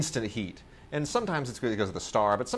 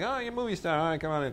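higher, lightly put-on eager old man; a touch of a starstruck old man; old manager fawning; 8 semitones higher, much faster than usual. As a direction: gush a little over the movie star. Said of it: "He's like".

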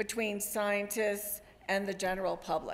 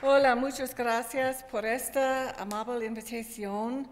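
Spanish with a real American accent; careful Spanish from an English speaker. Real, not acted. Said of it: "mi español no es muy bueno".

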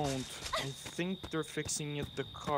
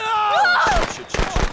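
shrieking in real panic; panicked scream; 14 dB louder, slower than usual. Real, not acted. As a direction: scream it, terrified and loud. Sudden jump scare in game.

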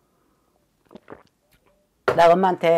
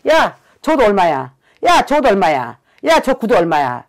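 louder, cocky calls to a clerk; flaunting rich lady asking prices; smug shopping; 3 semitones higher, 7 dB louder than usual. Retold or imitated. Shaman mimics the client's old self calling louder and cocky in a department store. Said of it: "깐죽건다고 이야기했죠".

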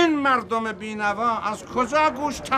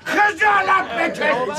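outraged shout; 11 semitones higher, 4 dB louder, slower than usual. Acted. Son outraged at insult to mother.

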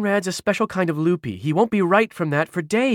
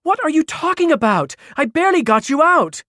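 high, loud exasperated protest; incredulous; 7 dB louder than usual. A narrator's reading. Mari who just rescued Caro, incredulous. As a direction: fire it back in disbelief.

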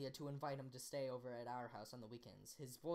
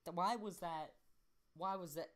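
plain reading voice

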